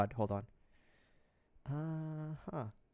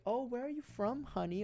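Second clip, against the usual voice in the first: put-on sweet old lady, higher and softer; 9 semitones higher, 7 dB quieter than usual. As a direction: imitate an old lady asking politely, high and soft. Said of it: "when it's an old lady asking me".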